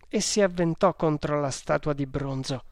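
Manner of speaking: ordinary delivery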